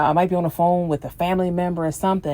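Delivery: normal talking voice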